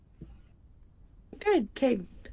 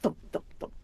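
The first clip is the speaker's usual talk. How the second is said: whispered thump sound; 10 dB quieter, much faster than usual. Acted. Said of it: "all you hear is a".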